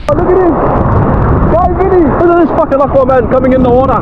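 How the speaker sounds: loud, high cheering; excited cheering. Real, not acted. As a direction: hype your mate up. Cheering a friend into water.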